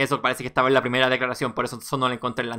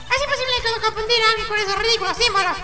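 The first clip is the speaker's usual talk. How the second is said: very high, squeaky put-on voice; indignant character. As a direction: squeal in disbelief at the absurdity. Personaje indignado, voz aguda. (indignación incrédula con voz muy aguda)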